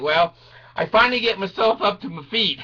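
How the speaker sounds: ordinary talk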